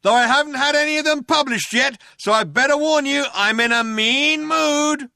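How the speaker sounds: high, loud cop shout; shouted warning. A narrator's reading. Second cop warning the fugitives of his mood, shouting. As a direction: shout the warning, stretching out the end.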